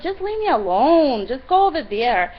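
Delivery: high, loud, fed-up retelling; exasperated plea. Retold or imitated. Rider telling pushy woman to go away, exasperated. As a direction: act out being at the end of your patience.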